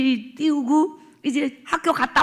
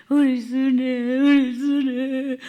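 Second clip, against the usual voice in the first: slow, grieving repetition of the name; tearful murmur; 4 dB louder, much slower than usual. Retold or imitated. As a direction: linger on the name as if in tears. Mother weeping over daughter.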